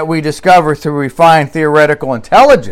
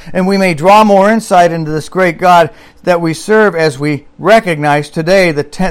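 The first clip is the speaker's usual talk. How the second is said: normal talking voice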